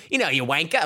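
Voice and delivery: high, teasing Australian imitation; mock Australian accent. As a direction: toss it off in a put-on Australian accent. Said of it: "like, a decent little accent there".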